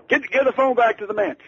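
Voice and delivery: high, loud, brusque demand; high-pitched, loud impersonation of a famous singer on the phone. Retold or imitated. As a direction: bark it down the phone like a star. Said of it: "shouting so loudly".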